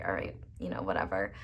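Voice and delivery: breathy, resigned shrug off; dismissive whisper. Retold or imitated. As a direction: toss it away under breath. Shrugging off a distant person.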